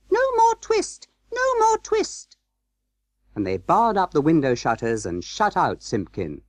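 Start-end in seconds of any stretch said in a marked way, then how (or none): 0.1-2.4 very high, tiny mouse cry; little twittering voices. A narrator's reading. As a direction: squeak it out as mice calling together. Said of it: "shout at once in little twittering voices".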